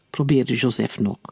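ordinary delivery

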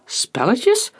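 high, rising question; incredulous echo. A narrator's reading. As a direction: throw the word back in disbelief. Scout disputes the accusation.

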